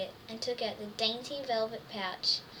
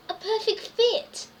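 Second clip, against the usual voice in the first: delighted; 8 semitones higher, 6 dB louder, slower than usual. Acted. Delighted reading aloud to children.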